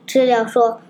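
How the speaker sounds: usual speaking voice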